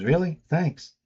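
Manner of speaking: imitating a thrilled boy who can't believe it; excited. Boy thrilled she said yes.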